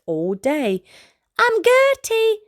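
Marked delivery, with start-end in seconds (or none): 1.4-2.4 much higher, louder giraffe voice; high giraffe call. Acted. Gertie the giraffe calling to Alfie, high and light. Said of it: "she called".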